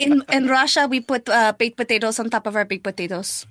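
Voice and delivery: put-on Russian accent; comic Russian voice. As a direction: explain it earnestly in a mock Russian accent. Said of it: "we talk in a Russian accent".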